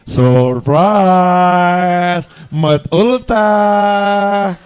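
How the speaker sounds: loud, drawn-out party cheer; family jumping out for the birthday, loud cheer; shouted surprise. Retold or imitated. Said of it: "semua teriak".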